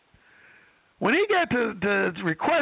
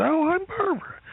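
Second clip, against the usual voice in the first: flattered woman; 9 semitones higher than usual. Retold or imitated. Woman introduces herself, flattered.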